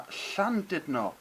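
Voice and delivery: "Llandudno" slowly with ll as air pushed past the cheeks; slow Welsh demo. Shown as a demonstration. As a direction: breathe the ll in "Llandudno". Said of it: "double L sound ... pushed air".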